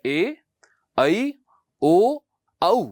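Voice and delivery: teacher models the correct Sanskrit sound; correct Sanskrit vowels. Shown as a demonstration. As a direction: correct pronunciation of ए ऐ ओ औ, slow and clear. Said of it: "इसका सही उच्चारण है".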